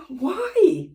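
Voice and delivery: high, loud mock astonishment; incredulous. Challenging the doorbell presser, acted incredulous. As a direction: demand a reason, baffled.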